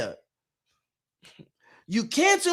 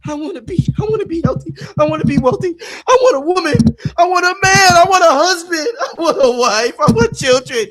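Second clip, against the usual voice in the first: higher, louder pleading with a wail; mock begging; 5 semitones higher, 5 dB louder than usual. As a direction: whine like a desperate supplicant. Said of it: "we start begging them".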